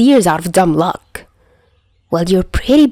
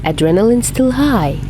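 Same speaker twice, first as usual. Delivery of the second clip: plain reading voice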